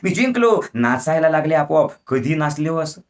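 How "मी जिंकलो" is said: high-pitched victory cry; विजयाचा जल्लोष. Acted. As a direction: cheer like a winner, voice jumping up high. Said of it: "जिंकले आणि ओरडले". (जिंकल्याच्या आनंदात उंच आवाजात आरोळी)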